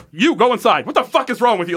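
high, sharp retold scolding; mother scolding a stranger with a cape, angry; angry outburst. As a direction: snap at him like an outraged mother. Mother angrily confronting the cape.

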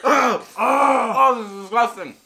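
loud pained outcry; disgusted shout. Real, not acted. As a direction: yell it out in disgust. Reacting to spicy chip.

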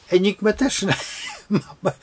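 laughing through the words; amused ironic chuckle. Real, not acted. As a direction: break into a real laugh mid-sentence. Ironic remark about Enigma.

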